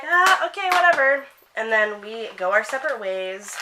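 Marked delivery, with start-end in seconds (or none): 0.0-1.2 much higher and louder, brushing her off; dismissive whatever. Retold or imitated. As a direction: shrug her off with a loud fake-bright whatever. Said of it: "I just was like".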